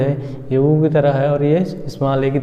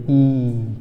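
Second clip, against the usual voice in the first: बड़ी ई slowly stretched; slow teacher demo of long ee; drawn out. Shown as a demonstration. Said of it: "वो क्या होगा?".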